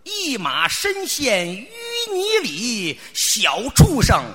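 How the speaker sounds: slow recital ending in a loud shout; hammed-up couplet recital with shouted ending; grand recitation. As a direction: drawl the verse then hurl the insult.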